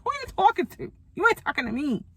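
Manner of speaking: high, indignant challenge; who do you think you're talking to; defiant comeback, pitched high; sassy comeback. Retold or imitated. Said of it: "I always wanna be like".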